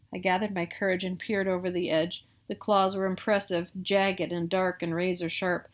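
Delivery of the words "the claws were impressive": ordinary delivery, nothing marked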